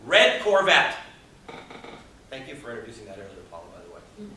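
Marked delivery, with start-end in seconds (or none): 0.0-1.0 "Red Corvette" said louder, pitch lifted; punched key phrase. Shown as a demonstration. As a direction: land it like the punchline. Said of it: "I'm gonna say something very important right here".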